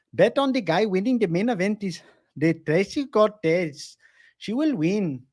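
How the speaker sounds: put-on light Indian accent; mock Indian English lilt. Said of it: "do your Indian accent".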